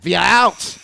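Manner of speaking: playful fake German accent exclaiming; bad German accent. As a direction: belt it in a mock German accent. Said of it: "my bad German accent".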